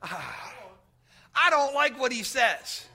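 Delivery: lightly voiced grudging king with a sigh; a hint of a sulky king, drawing it out; reluctant Ahab. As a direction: Ahab grumbling about the one true prophet. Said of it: "Ahab reply".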